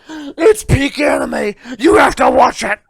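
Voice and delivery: slow, loud mocking fan shout; mocking a shouting anime fan, slow and loud; mock obsessed fan. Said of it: "you guys lost your mind".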